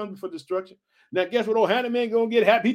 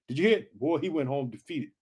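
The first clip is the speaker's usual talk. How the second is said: usual speaking voice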